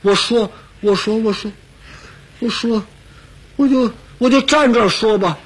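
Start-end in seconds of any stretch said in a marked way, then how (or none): none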